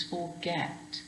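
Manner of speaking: "forget" said slowly, weight on GET; stressed last syllable. Shown as a demonstration. Teacher contrasting it with fasten, "forget" stressed on GET. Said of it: "that get is more stressed".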